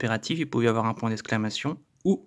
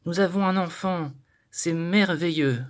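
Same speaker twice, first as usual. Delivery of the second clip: joyful exclamation; 5 semitones higher, 3 dB louder than usual. Shown as a demonstration. Teacher acting a happy parent, exclaiming with joy. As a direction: gush with happiness.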